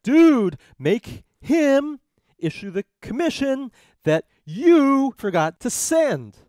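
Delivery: loud, high, slow hammed-up complaint; mock indignant demand. Marbury petitioning Marshall for his commission, mock indignant. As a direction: demand it loudly, leaning on each word.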